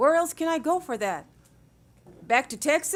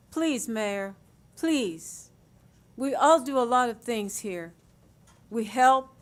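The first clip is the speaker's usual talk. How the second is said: ordinary talk, nothing marked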